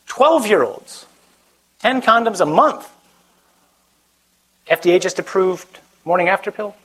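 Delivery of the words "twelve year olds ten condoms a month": high, slow, incredulous emphasis; appalled disbelief. Real, not acted. Preacher to young crowd about shocking news, appalled. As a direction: stretch it out, pitched up in disbelief.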